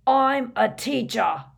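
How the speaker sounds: slow, loud, outraged roar; high, furious bellow. A narrator's reading. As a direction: roar it with wounded authority. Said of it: "he roared at Harry".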